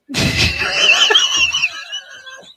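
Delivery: big loud cackle, head thrown back; loud burst of laughter. Real, not acted. Cohost accused of mocking accent, loud laugh. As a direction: laugh out loud, high and hard.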